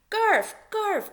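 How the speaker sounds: high, loud call across the room. A narrator's reading. Lana calling to Garf across the room, high and loud. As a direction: call his name out high. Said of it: "called Lana".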